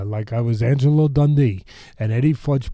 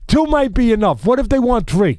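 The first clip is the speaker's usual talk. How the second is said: high, louder fretting over food; raised-pitch mock alarm; worried insistence; 9 semitones higher, 5 dB louder than usual. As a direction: imitate an anxious host pressing the point. Retold argument over chicken wings.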